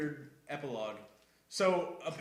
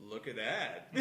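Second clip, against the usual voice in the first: hushed aside; 7 semitones lower, 8 dB quieter, slower than usual. Retold or imitated. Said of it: "it's very much just like".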